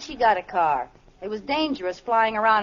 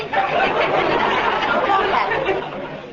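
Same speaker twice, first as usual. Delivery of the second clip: breathy high giggle; 11 semitones higher, 3 dB louder than usual. Acted. Wife giggling at husband behind torn paper. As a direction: laugh at your own prank. Liz laughs at her trick.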